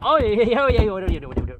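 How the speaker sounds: loud, high whoops of joy; a burst of delighted yeahs; excited cheering. Real, not acted. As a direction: cheer the catch home, real and loud. Cheering as fish lands.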